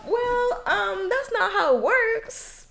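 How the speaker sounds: high, slow, know-it-all tone; condescending correction. Retold or imitated. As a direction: correct them with exaggerated patience. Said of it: "j'étais comme".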